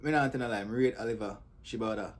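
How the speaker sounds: ordinary delivery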